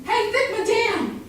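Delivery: man hollering after a woman in the street, mock catcall; imitating a catcaller. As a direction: call out like a pushy street guy. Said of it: "He was just yelling at me".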